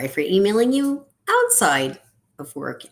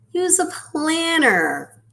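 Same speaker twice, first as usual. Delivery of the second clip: mocking; 12 semitones higher, 5 dB louder, slower than usual. Mocking advice heard as a child.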